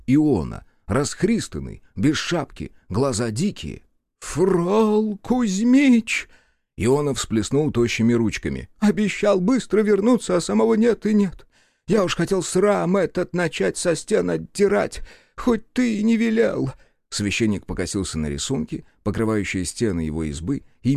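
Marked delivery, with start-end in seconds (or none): 4.2-6.3 drawn-out high wail of a panicked priest; a wild-eyed priest crying out the name, much higher and stretched; высокий протяжный вопль перепуганного попа; frantic priest. Acted. Frantic priest bursts out calling. 8.8-16.7 high, whiny priest voice complaining; a flustered village priest grumbling up high; fussing reedy priest, much higher; fretful priest. Acted. Priest Iona's voice.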